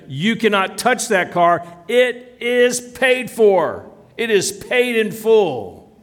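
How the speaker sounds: high, emphatic declaration, slowing down; firm insistence. Acted. Car payer confronting the collection agency with receipt, firm insistence. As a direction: stand your ground, hammering each word.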